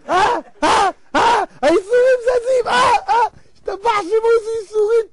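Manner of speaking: high, loud gasps of relief; hammed-up rising jubilation; acted elation. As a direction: cry out excitedly as the scale moves. Delighted as sufferings tip the scale.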